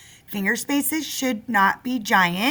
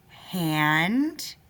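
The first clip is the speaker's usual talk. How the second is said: usual speaking voice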